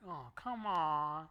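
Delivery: drawn-out, slow, grossed-out oh come on; disgusted groan. Retold or imitated. Friends watching a girl vomit, slowly disgusted. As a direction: stretch it like you can't believe it.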